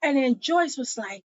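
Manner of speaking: ordinary talk, nothing marked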